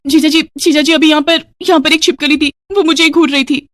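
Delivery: high, urgent, frightened report; panicky. Acted. Woman telling brother-in-law about a lizard, panicky. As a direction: blurt it out in a high-pitched fluster.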